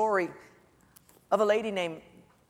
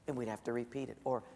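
ordinary talk

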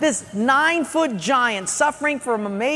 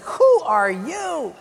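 drawn-out, higher challenging question; mock incredulity; 5 semitones higher, slower than usual. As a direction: slowly question who he thinks he is. Doubters turn on David.

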